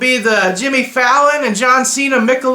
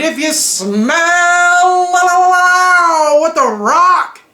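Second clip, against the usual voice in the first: drawn-out, sung-out high wrestler catchphrase; slow, sing-song The Rock impression, pitched up; 7 semitones higher, much slower than usual. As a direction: stretch it like a big arena hype line. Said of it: "The guy who coined".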